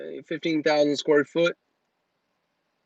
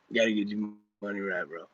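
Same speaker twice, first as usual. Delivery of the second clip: lower, softer, fast aside in a light guru voice; Grant impression, muttered; 7 semitones lower, 8 dB quieter, faster than usual. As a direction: mutter the money lecture quickly under your breath. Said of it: "that was my Grant impression".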